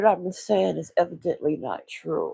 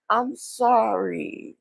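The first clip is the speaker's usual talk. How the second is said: high, long drawn-out sorry; sympathetic apology; 5 semitones higher, much slower than usual. Retold or imitated. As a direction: stretch the apology out tenderly. Retold apology to sad man.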